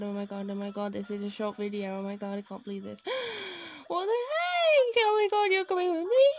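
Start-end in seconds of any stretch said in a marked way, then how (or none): 3.9-6.2 much higher, louder squeal of joy; real excited squeal; thrilled shriek. Real, not acted. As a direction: squeal like you just won. Dream villager found.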